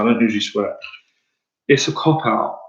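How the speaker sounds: usual speaking voice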